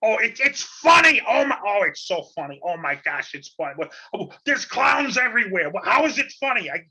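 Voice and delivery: loud, high-pitched comic outbursts; Gilbert Gottfried. Acted. Podcast host opens the show impersonating a comedian, Gilbert Gottfried impression. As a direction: exclaim it high and loud, dragging out the punchlines. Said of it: "if that's a good Gilbert Gottfried impression".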